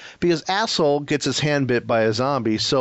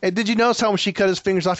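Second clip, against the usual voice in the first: ordinary talk, nothing marked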